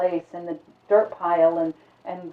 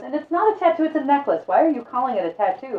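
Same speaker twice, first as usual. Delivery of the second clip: high, loud, puzzled objection; baffled protest; 7 semitones higher, 5 dB louder than usual. Acted. Stranger correcting the librarian about his necklace, high baffled protest. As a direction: insist it's just a necklace, confused. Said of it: "and he goes".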